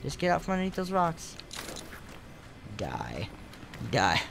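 normal talking voice

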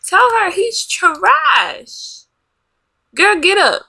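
high-pitched, indignant urging; outraged urging. Real, not acted. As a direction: urge her on in outrage. Urging her game character on.

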